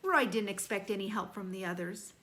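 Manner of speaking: ordinary delivery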